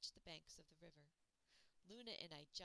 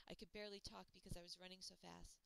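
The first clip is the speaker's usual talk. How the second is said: ordinary delivery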